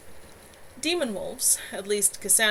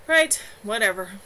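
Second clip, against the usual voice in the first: loud, offhand brush-off; dismissive to Kevin; 7 dB louder than usual. A narrator's reading. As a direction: wave the point away. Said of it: "Laurie shrugged dismissively".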